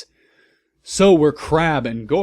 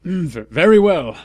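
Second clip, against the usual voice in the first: higher, louder, hesitant; said angrily; 5 semitones higher, 6 dB louder than usual. A narrator's reading. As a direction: Dumbledore answering the officials, grudging angry voice. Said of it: "Dumbledore said angrily".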